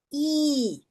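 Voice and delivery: "i" held long as one pure sound; teacher's drawn-out "i". Shown as a demonstration. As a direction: stretch the "i" out. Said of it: "é um i comprido".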